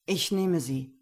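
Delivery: calm claim, a touch of command; Gero's voice. Acted. Gero claims the woman at auction, firm.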